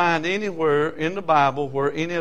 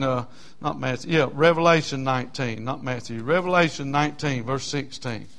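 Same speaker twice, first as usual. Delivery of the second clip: ordinary delivery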